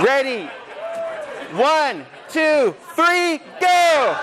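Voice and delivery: shouted countdown. Real, not acted. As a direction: count down to the whole room.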